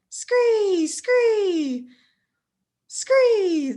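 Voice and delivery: high, squeaky rat screeches; rat squeaks. Acted. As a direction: screech it like a swarm of rats. Reader voices rats squeaking.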